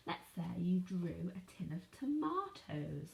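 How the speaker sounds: ordinary talk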